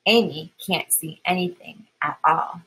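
usual speaking voice

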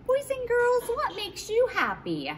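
put-on high, bright questions to kids; kids show host. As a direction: ask the kids, pitch way up. Asks the children directly.

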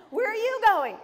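chatty seatmate eagerly asking where she's headed; eager chatty question. Retold or imitated. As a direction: pepper her with friendly curiosity. She mimics his eager question.

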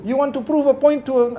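ordinary talk

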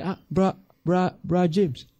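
high, loud, slowing friend intervening; mock alarm. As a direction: act out stopping an overeager friend, loudly. Acting out correcting a disciple at dinner.

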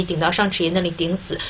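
normal talking voice

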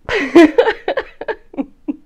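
high burst of laughter; real laugh; loud laugh. Real, not acted. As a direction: crack up. Laughs at no titties.

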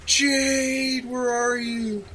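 very high, drawn-out mock scream at speaking volume; imitated screaming. Boyfriend searching campus for his girlfriend, imitated screaming of her name. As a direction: call out like a cheesy romantic comedy, held back in volume. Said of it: "screaming her name".

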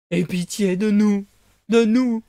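slow, loud begging plea; whining fox. Acted. Fox begging Pinocchio not to leave, slow and loud. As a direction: drag out each word for pity.

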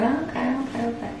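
high, very soft placating murmur; humouring. Retold or imitated. Humouring sister about her odd recipe, placating.

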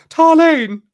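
high, loud, drawn-out call; high-pitched sorceress. Acted. Sorceress calls out for Tarlene, high-pitched voice. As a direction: sing out the name loudly, way up high. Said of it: "you hear a high-pitched voice".

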